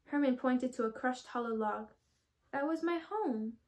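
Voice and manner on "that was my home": slow, higher, mildly tearful; sniffling sadly. Acted. Skunk showing his crushed log home, slow and mildly tearful. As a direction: say it like holding back a sniff. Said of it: "sniffed Herman".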